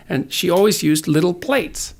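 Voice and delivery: normal talking voice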